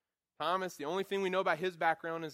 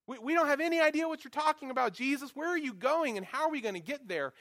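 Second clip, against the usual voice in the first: bewildered whine; 9 semitones higher, 5 dB louder than usual. Retold or imitated. Thomas confused, questioning Jesus.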